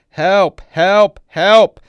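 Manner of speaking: high, very loud repeated screams; full-voiced cries for rescue; screaming for help. A narrator's reading. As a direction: scream it out in rising panic. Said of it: "continues screaming".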